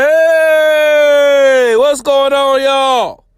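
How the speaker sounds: loud high host shout-out; host hollering hello to listeners; hype greeting. Acted. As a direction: boom the opening hello to the audience. Podcast opening shout.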